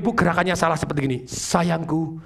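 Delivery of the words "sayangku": low, slow romantic declaration; tender lover. Shown as a demonstration. Trainer playing a lover with right tone but wrong gesture, tender. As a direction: croon it slowly and softly, full of affection. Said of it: "Nadanya tepat, pilihan katanya tepat".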